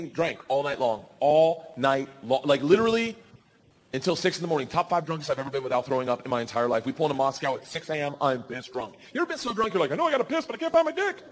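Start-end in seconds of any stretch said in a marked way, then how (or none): 1.2-2.4 ALL NIGHT LONG, very slow, word by word; "all ... night ... long", each word dragged out; drawn-out emphasis. Shown as a demonstration. 9.9-11.2 lightly played drunk, pitched high; high-pitched drunk complaint; mock drunk. Acting out a drunk person.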